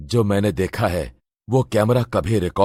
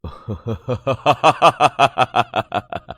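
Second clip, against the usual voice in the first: long, falling villain cackle; evil demon laugh; 4 semitones higher than usual. Acted. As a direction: laugh down at the old sage with contempt. Demon mocks the sage.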